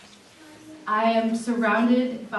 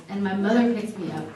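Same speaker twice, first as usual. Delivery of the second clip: usual speaking voice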